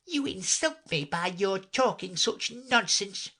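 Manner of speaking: slow, high, offended voice; walk off in a huff; indignant mouse. A narrator's reading. Offended mouse walks away.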